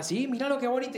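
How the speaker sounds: high-pitched retold enthusiasm; imitated enthusiasm. Papá entusiasta muestra la canción. (imitando a un papá entusiasmado)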